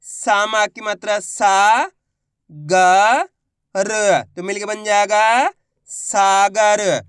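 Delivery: slow sounding-out, सा held long; syllable-by-syllable demo. Shown as a demonstration. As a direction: separate ग and र, then blend सागर. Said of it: "तो कैसे पढ़ेंगे".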